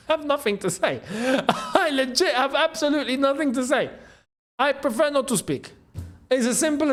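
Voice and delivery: helpless laughter through the words; laughing in disbelief. Real, not acted. Stunned by Ronaldo's contract figures, laughing.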